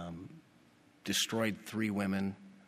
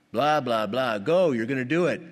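mocking false prophets; 2 semitones higher, 6 dB louder than usual. Mocking the four hundred prophets.

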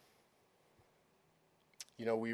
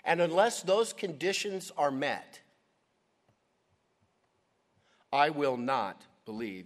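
emphatic refusal; 3 semitones higher, 7 dB louder than usual. Acted. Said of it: "the last sentence there in verse twenty-five".

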